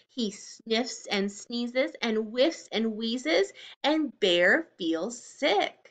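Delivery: slow, sing-song storybook reading; reading to children. A narrator's reading. Teacher reading a picture book to the class. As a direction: read it slowly to little ones.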